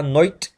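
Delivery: "नोइट" with an oi vowel for night; English-accent pronunciation. Shown as a demonstration. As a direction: stretch "नोइट" in a put-on British accent. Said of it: "नाइट को आपने बोलना है नोइट".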